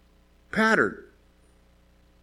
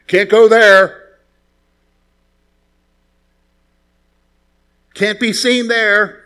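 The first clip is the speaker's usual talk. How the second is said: slow, loud, stern church scolding; mock strict church voice; 4 semitones higher, 9 dB louder, slower than usual. Church elder slowly forbidding restaurants, stern and loud. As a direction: drag out each forbidding word like a strict elder.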